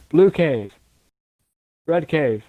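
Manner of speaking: loud, drawn-out callouts to the team. Shown as a demonstration. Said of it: "try to get louder with it, but still slow".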